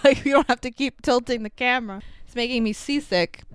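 ordinary delivery

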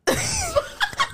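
squealing high laugh. Real, not acted. Laughing at juice to brim.

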